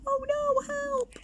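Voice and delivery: very high mock cry for help; mock fright. Skeletons scared by the ghost, very high mock cry. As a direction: squeak in pretend panic.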